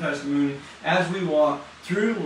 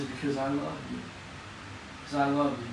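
tender voice of God; 3 semitones lower, 7 dB quieter than usual. Retold or imitated. Voicing God's tender answer.

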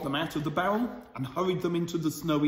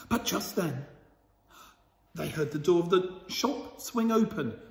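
plain reading voice

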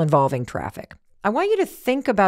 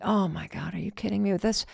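much softer, under-the-breath exasperation; exasperated; 9 dB quieter than usual. Retold or imitated. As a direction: mutter it to yourself, fed up. Impatient customer in line.

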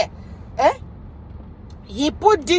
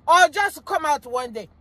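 ordinary delivery

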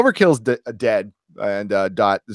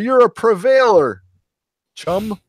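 high, louder mock-heroic proclamation; hammed-up hero; 10 semitones higher, 4 dB louder than usual. Tick finds Arthur and hails him, hammed-up heroic cheer. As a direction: imitate a big superhero hailing his sidekick.

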